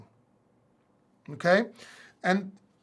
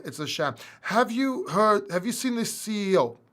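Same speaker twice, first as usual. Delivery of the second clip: normal talking voice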